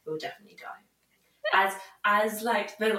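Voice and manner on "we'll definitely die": whispered fear. Retold or imitated. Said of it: "I was like".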